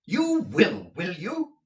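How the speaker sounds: very high, loud outburst; blustering. A narrator's reading. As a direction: explode at him. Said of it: "my guardian blustered out".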